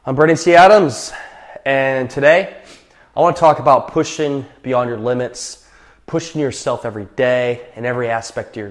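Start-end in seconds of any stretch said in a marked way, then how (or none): none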